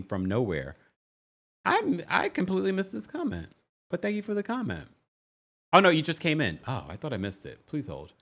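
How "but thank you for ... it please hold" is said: ordinary delivery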